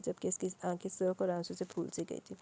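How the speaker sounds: usual speaking voice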